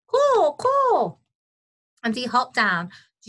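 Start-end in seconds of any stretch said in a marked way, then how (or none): 0.0-1.2 high, loud bird caws; two piercing, drawn-out caws; jackdaw cawing. A narrator's reading. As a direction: caw shrilly like a jackdaw. Jackdaw calls in a story.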